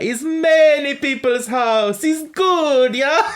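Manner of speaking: put-on high, loud, slow Italian accent; cheerful Italian host, slowly. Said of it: "Am I allowed to do an Italian accent?".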